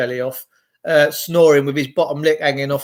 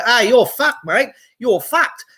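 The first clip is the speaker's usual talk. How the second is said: mock Australian, higher pitched and drawn out; Australian accent; 6 semitones higher than usual. Impersonating an Australian scolding someone, higher Aussie accent. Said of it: "he's gonna go".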